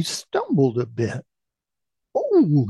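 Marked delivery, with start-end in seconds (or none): 2.1-2.6 sudden high, loud "oh"; cry of surprise. A narrator's reading. Boy stumbling on a mountain trek, high startled cry. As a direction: yelp it out high as you stumble. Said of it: "he cried out".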